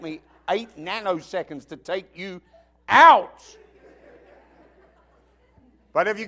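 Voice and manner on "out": shouted punchline; sudden loud punch. Pretend. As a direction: slam the last word out loud. Mock threat to take someone out.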